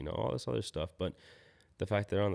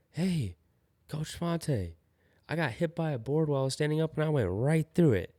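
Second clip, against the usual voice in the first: put-on higher, louder, a kid proudly reporting to his coach; imitating an excited kid; 8 semitones higher, 5 dB louder than usual. Skate kid tells coach he pushed through, high and excited. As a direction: play the boy bragging he pushed through the hit. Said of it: "he even tells me at the end".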